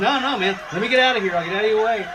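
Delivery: high, loud, laughing mock cop voice; laughing cop impression. Retelling a cop who backs off from a film crew, laughing mock cop voice. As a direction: ham up the apologetic cop while cracking up. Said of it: "He goes".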